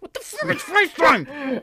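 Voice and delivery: very high, loud indignant voice; mock outrage. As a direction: squeak it in fake outrage.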